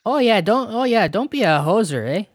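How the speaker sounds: high, sing-song Canadian hoser chatter; mock Canadian hoser. Podcaster trading Canadian slang with co-host, mock hoser.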